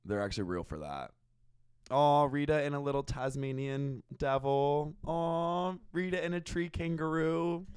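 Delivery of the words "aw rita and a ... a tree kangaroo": cooing over cute animals. Real, not acted. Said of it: "I'm gonna cry".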